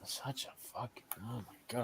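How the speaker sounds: barely voiced, near-whispered disbelief; dismayed murmur. Real, not acted. Fan to himself after another foul ball, hushed dismay. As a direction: mutter it very softly, almost without voice.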